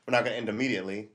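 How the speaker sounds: quick rushed delivery; fast rush. Shown as a demonstration. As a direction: rattle it off quickly. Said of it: "fast repeat".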